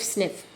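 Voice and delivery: soft breathy sniff as with a cold. Shown as a demonstration. Teacher showing children a cold, soft sniff. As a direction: sniff as if you have a cold. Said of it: "We keep on doing this".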